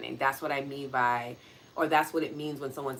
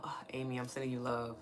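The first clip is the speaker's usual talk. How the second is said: whispering; 4 semitones lower, 10 dB quieter than usual. Real, not acted. Tender aside to a listener.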